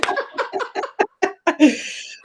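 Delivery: a bright, loud burst of laughter; loud high laugh. Real, not acted. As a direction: laugh it off, relieved. Laughing after emotional moment.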